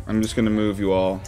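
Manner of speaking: ordinary talk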